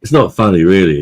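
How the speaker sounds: firm insistence. Real, not acted. Insisting after laughing.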